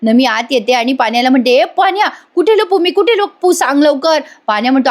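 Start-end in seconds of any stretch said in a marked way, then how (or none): 1.5-4.2 put-on high, panicky girl pleading to hide; a panicked young woman begging her friend; frantic girl. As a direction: beg for a hiding place, urgent and high. Nami frantically hiding from kidnappers.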